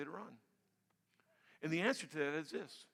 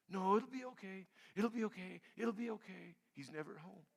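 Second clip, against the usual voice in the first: soft denial; 7 dB quieter than usual. Retold or imitated. Inner denial about absent husband.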